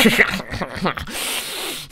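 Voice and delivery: long, high burst of laughter; laughing hard. Real, not acted. Reactor cracking up at the clip, real laughter. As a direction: crack up helplessly.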